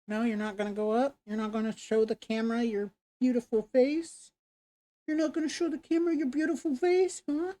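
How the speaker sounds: talking to a dog. Real, not acted. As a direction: tease the dog in falsetto. Teasing baby talk to dog.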